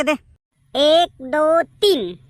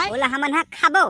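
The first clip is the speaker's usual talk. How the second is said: ordinary talk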